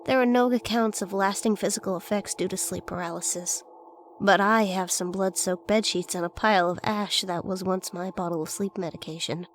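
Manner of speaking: plain reading voice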